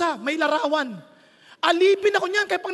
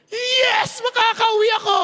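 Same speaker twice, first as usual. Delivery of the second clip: high, hammed-up cheer of relief; mock joyful cheer; 5 semitones higher, 3 dB louder than usual. Preacher acting his own glee at a free trip home, high and excited. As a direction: crow it like a kid let out of school.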